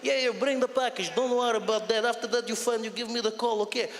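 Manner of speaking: put-on Russian-accented English like a DJ mix; English scratched into Russian. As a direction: rattle off phone orders in a Russian accent. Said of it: "someone took a D J of English".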